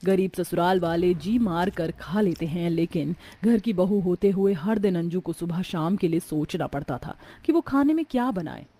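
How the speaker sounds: plain reading voice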